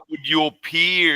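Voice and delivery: slow, drawn-out put-on Australian accent; mock Australian accent. Shown as a demonstration. Host re-asks his Australian guest in a mock Australian accent. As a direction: stretch "would your peers" slowly in a hammed-up Australian accent. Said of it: "I should speak Australian".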